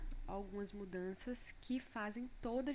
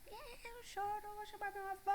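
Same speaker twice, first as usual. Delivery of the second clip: much higher child's whine, imitating daughter; whiny child; 12 semitones higher than usual. Mother imitating her daughter's whiny threat to cry. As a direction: play the toddler threatening to cry. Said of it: "E aí foi aquele".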